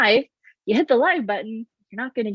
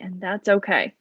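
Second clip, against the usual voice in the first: ordinary talk, nothing marked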